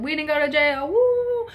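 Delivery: party cheer; celebrating. Retold or imitated. Celebrating with friends.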